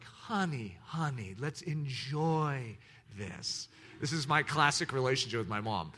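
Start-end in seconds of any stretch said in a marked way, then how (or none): 0.0-3.7 very soft, slow motherly coaxing; a mother gently slowing her anxious son down; soft soothing mom. Retold or imitated. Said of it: "my mom's like".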